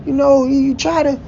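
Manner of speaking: a teasing friend's advice, voiced high; mock voice of A box. A friend called A box giving advice, in a high mock voice. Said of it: "A box said".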